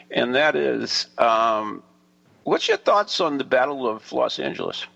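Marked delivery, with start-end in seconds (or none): none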